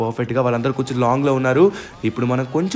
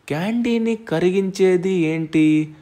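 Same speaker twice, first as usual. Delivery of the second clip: slow, drawn-out question, slightly raised; slow pondering; 4 semitones higher, much slower than usual. Acted. Gamer puzzles over how to melt the candy, slow pondering. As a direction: think it through aloud, stretching the words.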